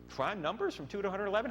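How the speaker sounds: normal talking voice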